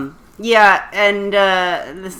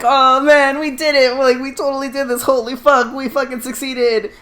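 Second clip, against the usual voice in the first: higher, gushing acted relief; mock elated celebration; 7 semitones higher than usual. Revolutionaries after the getaway, imitated giddy celebration. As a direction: play a crew amazed at its own success.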